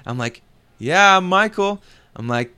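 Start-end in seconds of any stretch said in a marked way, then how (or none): 0.8-1.8 high, loud, drawn-out answer; retold calling out. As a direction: call it over to a stranger, pitched up. Retells answering a stranger warily.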